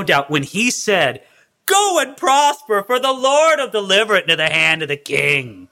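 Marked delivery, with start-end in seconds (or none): 1.6-5.7 sarcastic blessing with a high, stretched "Go and prosper"; mock the king with an overblown prophecy, falling away. Prophet answering King Ahab, openly mocking. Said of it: "he was mocking him".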